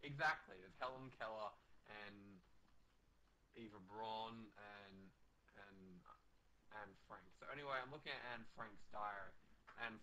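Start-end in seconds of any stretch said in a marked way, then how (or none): none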